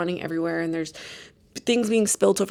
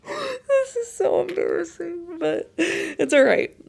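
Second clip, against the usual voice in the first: high, breaking laugh through tears; laugh-crying; 9 semitones higher, slower than usual. Real, not acted. Telling viewers her embarrassing moment, laugh-crying. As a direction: laugh and cry at once, embarrassed. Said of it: "laugh cry".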